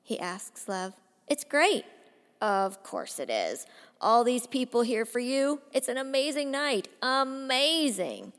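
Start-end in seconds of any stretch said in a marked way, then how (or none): 1.3-1.8 higher, louder eager reply; nervous boy faking enthusiasm; forced cheer. Acted. Teen forcing cheer for brother. 2.4-5.6 bitter, mocking praise of the night; older brother sneering at the celebration; sarcastic. Drunk brother mocking the party. 5.7-6.9 quick sarcastic praise. Acted. First take, quicker. 7.0-8.3 drawn-out sarcasm. Acted. Repeats word sarcastically, drawn out.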